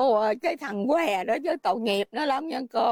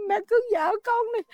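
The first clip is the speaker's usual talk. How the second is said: crying; 7 semitones higher than usual. Real, not acted. Son pleading, told through tears.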